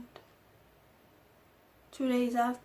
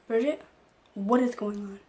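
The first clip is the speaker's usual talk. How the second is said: alarmed demand; 7 dB louder than usual. Retold or imitated. Adoptive mom phoning Bridget to demand what is going on, loudly. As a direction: demand answers down the phone.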